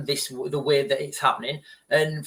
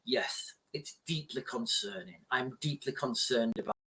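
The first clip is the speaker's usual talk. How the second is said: much softer, lower mock politician murmur; Keir Starmer impression; 4 semitones lower, 11 dB quieter than usual. Vlogger mocks a party leader's empty statement, hushed and low. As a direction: do a hushed, grave politician saying nothing. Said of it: "like Keir Starmer will just say".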